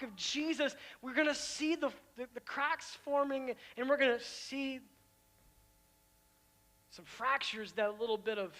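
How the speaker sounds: loud, high, driving sermon climax; fiery preacher building to a peak; full-force preaching. Acted.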